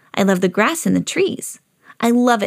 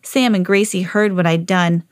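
plain reading voice

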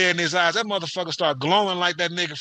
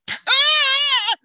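a high, loud yelp of pain; retold scream; 7 dB louder than usual. Brother yelling as dad whips him, loud scream. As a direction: scream it like a kid getting whooped. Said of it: "you may hear".

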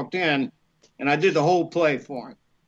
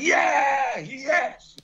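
higher, shouted cheer; retold cheer; 9 semitones higher than usual. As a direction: whoop like a fan at the win. Cheering at the Cubs win.

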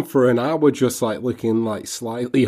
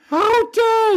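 mock outraged falsetto; high falsetto; 4 dB louder than usual. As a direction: squeal it way up high. Mocking the falsetto rant.

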